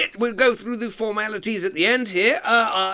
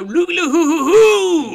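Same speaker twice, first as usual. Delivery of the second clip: mock celebratory whoop; 11 semitones higher, 9 dB louder, faster than usual. Announcing the winner's name.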